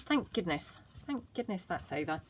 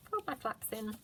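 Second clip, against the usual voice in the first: ordinary delivery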